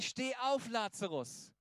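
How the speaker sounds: retold calling out. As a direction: call out a command as a preacher quoting. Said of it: "rief er und sagte".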